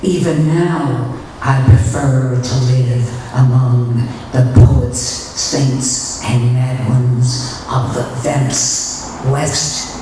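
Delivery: loud, fervent declaration; rising to a shout. Acted. Nun declaring her calling to the poets, loud and fervent. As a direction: proclaim it to the rafters, burning with zeal. Climactic declaration, much louder.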